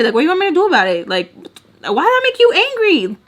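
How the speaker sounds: fast, high confrontational questions; intimidating mom. Retold or imitated. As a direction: challenge her, voice climbing high. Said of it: "my mom to me was intimidating and scary".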